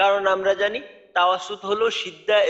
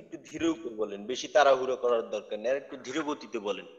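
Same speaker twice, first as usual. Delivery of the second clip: gentle coaching; 6 semitones lower, 6 dB quieter than usual. Real, not acted. Teacher gently coaching a student.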